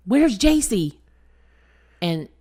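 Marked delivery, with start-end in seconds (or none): 0.1-1.0 retold scream. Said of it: "So I scream out".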